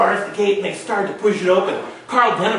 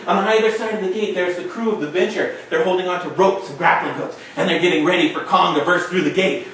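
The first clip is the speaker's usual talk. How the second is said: ordinary delivery, nothing marked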